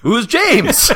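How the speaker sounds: loud, high mock demand; hammed-up bewildered cartoon soldier; soldier's question. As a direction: bellow the question in imitation. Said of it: "the guy goes".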